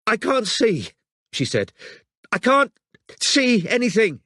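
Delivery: high, shaken woman's voice; frightened and struggling; distressed. Acted. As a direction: shaken distress after the attack. Injured woman panicking.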